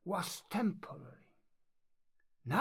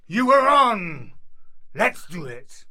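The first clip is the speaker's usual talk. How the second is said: bellowed, eager to fight; loud and ready for the duel; loud duel acceptance; 11 dB louder than usual. Acted. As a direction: shout back at the challenger.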